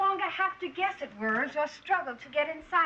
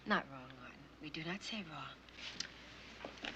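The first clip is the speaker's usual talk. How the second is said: low firm correction; 10 semitones lower, 14 dB quieter, slower than usual. Acted. Teacher corrects boy.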